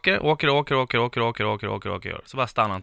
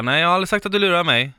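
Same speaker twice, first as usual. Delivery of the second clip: higher, louder and fast protest; heated denial; 7 semitones higher, 6 dB louder, faster than usual. Retold or imitated. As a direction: push back hard, fast. Hon försvarar sig i grälet. (upprörd, snabb protest)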